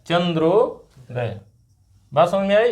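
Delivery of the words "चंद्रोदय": slow teacher's model of चंद्रोदय; drawn-out. Shown as a demonstration. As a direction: stretch चंद्रोदय out, holding the joined ओ. Teacher models joined word slowly.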